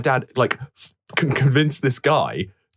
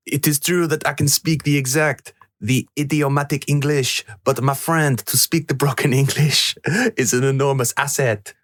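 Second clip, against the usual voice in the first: higher-pitched put-on French accent; 6 semitones higher than usual. Co-host reading Poirot's words on request, in a put-on French accent. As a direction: read it in a hammy French accent. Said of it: "in your French".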